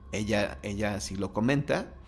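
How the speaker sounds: ordinary delivery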